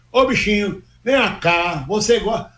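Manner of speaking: lightly put-on Bahian accent, paulista faking it; a São Paulo guy hamming up Bahian speech; paulista imitating Bahian. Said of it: "um paulista imitando baiano". (sotaque baiano imitado por paulista)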